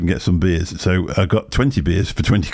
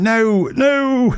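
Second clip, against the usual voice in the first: much higher, squeaky mock refusal; imitating Lloyd's protest. Lloyd fleeing the kiss, high mock protest. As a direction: play the fleeing man's panicked no. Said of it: "And Lloyd's going".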